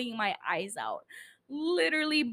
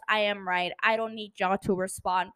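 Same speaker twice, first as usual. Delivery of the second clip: usual speaking voice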